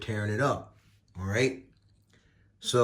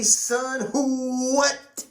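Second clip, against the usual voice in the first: excited exclamation; 13 semitones higher, 4 dB louder, much slower than usual. Real, not acted. Excited at the red parts.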